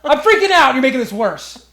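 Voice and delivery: high, loud acted yelling; hammed-up freak-out shout; panicked outburst. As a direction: yell the complaint in mock panic. Retold outburst at the doctor.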